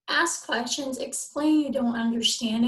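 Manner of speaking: ordinary talk, nothing marked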